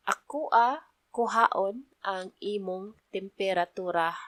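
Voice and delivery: "Ako a kuhaon ang imong temperatura" drawn out word by word; slow teaching pace. Shown as a demonstration. Teacher slows the phrase for beginners, deliberately slow. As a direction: spell the Bisaya phrase out slowly for learners. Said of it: "let me repeat that in regular pace".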